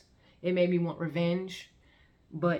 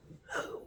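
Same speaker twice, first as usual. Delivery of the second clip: sharp gasp; 9 dB quieter than usual. Retold or imitated. Max startles awake.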